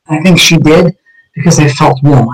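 usual speaking voice

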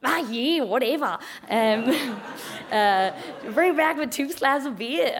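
lightly voiced easygoing bloke; laid-back mate. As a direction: imitate a mate shrugging it off. Imitates Max's laid-back reply.

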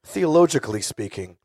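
lightly voiced inquisitive dad; a hint of a probing father; father's voice. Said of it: "My father will comb through".

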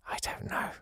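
a voiceless little shrug of a line; whispered aside. Real, not acted. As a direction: breathe it out as a whisper. Aside while puzzling over letter.

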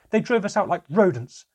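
loud, high-pitched angry yell; child's enraged outburst at a parent; furious shouting. Acted. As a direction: shout it like a blow landing. Child attacking father, shouting.

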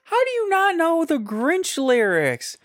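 high, loud, stunned outrage; outraged disbelief. Real, not acted. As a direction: cry out in disbelief.

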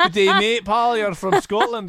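high, loud Scottish-tinged Australian; teasing Scottish take on Australian; mock Scottish Aussie accent. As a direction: mimic a botched Aussie accent gone Scottish. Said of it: "This is Scottish".

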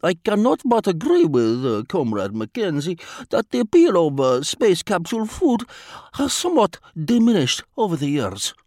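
put-on lightly accented, formal and unhurried Vladimir; a polite spy choosing words with care; foreign diplomat voice. A narrator's reading. Vladimir voiced in read story.

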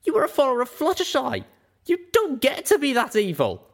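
fast, loud, high angry bark; barking angrily. A narrator's reading. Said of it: "I barked".

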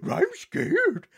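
yelpy, high cartoon dog fright; Scooby-Doo voice. Shown as a demonstration. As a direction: whimper like a scared cartoon Great Dane. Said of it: "found out then I could do Scooby-Doo".

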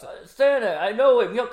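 high, loud excited yelling; mock Elf shout. Mocking Elf's excited shouting, high and loud. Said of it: "'cause he shouts".

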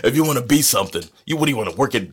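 loud, high hammed-up stern dad; stern father. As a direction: berate your son, over the top. Playing the abusive father.

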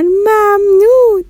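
high, slow, drawn-out happy thank you. Acted. Shadi and Neshat thanking Bahram, high and drawn out with joy. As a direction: sing out thanks with delight. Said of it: "با خوشحالی گفتن".

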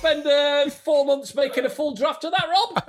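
sarcastic TV exec asking; mock producer.

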